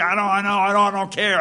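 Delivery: annoyed farmer's retold yell; exasperated outburst. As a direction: play the fed-up orchardist, raising the voice.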